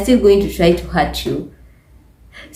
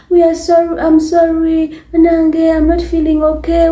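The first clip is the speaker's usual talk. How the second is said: soft appeasing apology; 6 semitones higher than usual. Retold or imitated. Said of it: "women have to be soft".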